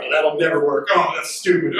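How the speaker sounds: low, sour grumbling; low, mock-grumpy complaining. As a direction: play the grumpy pessimist. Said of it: "We don't walk around going".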